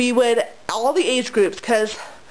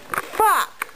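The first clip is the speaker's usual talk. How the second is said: pained curse; 9 semitones higher than usual. Real, not acted. Hurt after "Ouch.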